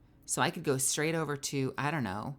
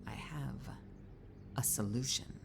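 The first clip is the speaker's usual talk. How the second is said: sly whisper; 6 semitones lower, 11 dB quieter than usual. Pretend. Whispered repetition.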